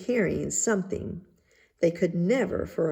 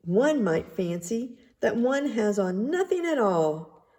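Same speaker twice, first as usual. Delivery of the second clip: ordinary delivery, nothing marked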